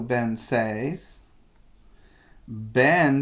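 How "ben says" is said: ordinary delivery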